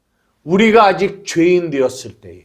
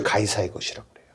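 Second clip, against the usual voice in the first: 속삭임; 12 semitones lower, 12 dB quieter, much faster than usual. Real, not acted. 결론을 낮게 말함.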